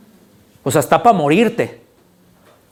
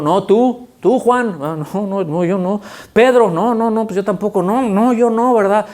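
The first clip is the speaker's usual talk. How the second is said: quick, higher back-and-forth voices; acted dialogue; 5 semitones higher than usual. Preacher acting out elders refusing the scroll, higher. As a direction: play the reluctant elders.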